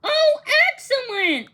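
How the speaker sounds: high, loud burst of delight. Acted. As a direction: greet the helpers with real joy. Danny greeting new helpers.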